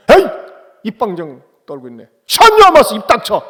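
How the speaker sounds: acted angry outburst, leaping high and very loud; yelling denial. Peter tells the accusing girl to shut up, yelling. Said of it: "막 소리소리 질렀어요".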